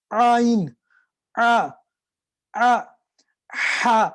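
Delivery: آئین squeezed, آ آ drawn, then ہا from the same spot; throat sound demo; ain then ha demo. Shown as a demonstration. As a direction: model the ain and ha from one throat point. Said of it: "جہاں سے آئین نکلے گا".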